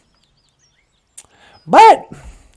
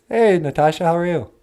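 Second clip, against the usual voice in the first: much lower, odd voiced character; low puppet voice; 10 semitones lower, 6 dB quieter, faster than usual. Puppet answering Natasha, deep voiced character.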